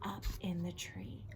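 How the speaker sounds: hushed suspense whisper. Shown as a demonstration. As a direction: breathe it like a secret. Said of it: "tell them what you think".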